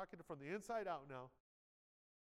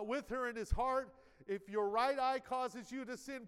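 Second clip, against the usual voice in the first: raised, loud preacher's reading voice; scripture reading; 5 semitones higher, 5 dB louder than usual. Real, not acted. Preacher reads Jesus' words to the congregation, forceful scripture reading. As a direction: proclaim the verse high and strong, driving onward.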